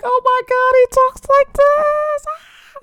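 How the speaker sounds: a fan losing it inside, stretched and high; starstruck squeal. Retold or imitated. Starstruck inner reaction to [name].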